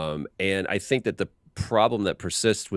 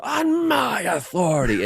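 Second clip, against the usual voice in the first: much higher, louder and slow, a hammed-up furious bark; angry read; 13 semitones higher, 5 dB louder, slower than usual. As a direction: blow the line up with mock rage. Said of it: "we just feel like you should be angry".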